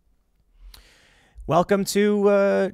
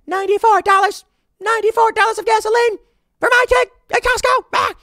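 much higher, louder hysterical shriek; mock screaming woman; 6 dB louder than usual. Mocking the viral woman screaming about gas prices, hammed-up shriek. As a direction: wail the price like it's the end of the world. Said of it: "this woman in her car screaming".

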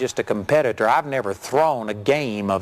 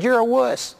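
higher-pitched mock jeer; retold insult; 9 semitones higher than usual. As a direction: needle him into taking the shot. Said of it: "I insulted him".

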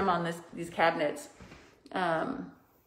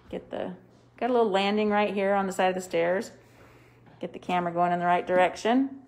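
usual speaking voice

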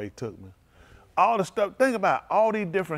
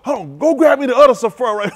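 much higher, much louder hammed-up fuss; mock frantic reader; 11 semitones higher, 13 dB louder than usual. Preacher mocking readers juggling many books, high frantic shout. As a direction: play the flustered reader calling for another book.

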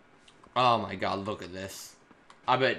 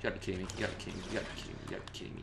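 repeating softer each time until voiceless; you gotta be kidding me trailing off to a breath; fading muttered disbelief; 5 semitones lower, 13 dB quieter, much faster than usual. Real, not acted. Player groaning after his teammate dies, fading to a breathy mutter.

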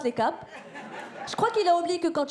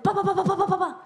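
window banging; 5 semitones higher than usual. Retold or imitated. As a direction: hammer it like a fist on glass.

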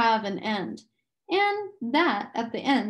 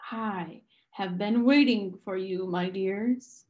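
gentle sinister man; 3 semitones lower than usual. A narrator's reading. The man on the platform greeting the children, gently. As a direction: welcome them kindly with something cold beneath. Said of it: "His voice was kind of gentle".

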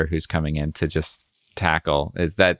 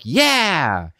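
mock group cheer; 10 semitones higher than usual.

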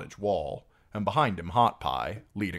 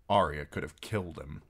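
plain reading voice

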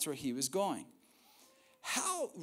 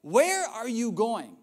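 insistent Peter; 5 semitones higher, 6 dB louder than usual. Retold or imitated. Peter pressing Jesus at dinner, loud and insistent. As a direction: push him, he needs an answer now. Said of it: "He's like".

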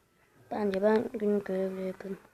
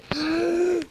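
sharp gasp; 6 semitones higher than usual. Real, not acted. Surprise in game.

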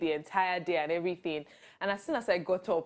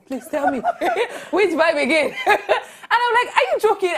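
high, louder laughing disbelief; 11 semitones higher, 8 dB louder than usual. Real, not acted. Retelling her reply to critics, laughing in disbelief.

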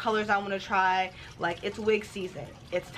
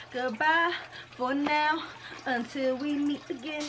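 sing-song farewell; 6 semitones higher than usual. Acted.

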